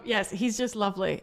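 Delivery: ordinary talk, nothing marked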